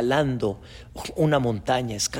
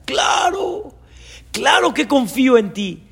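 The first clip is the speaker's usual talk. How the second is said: high, wailing eager yes; hammed-up climber's pleading agreement; frantic assurance; 9 semitones higher, 4 dB louder than usual. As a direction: insist desperately that you trust.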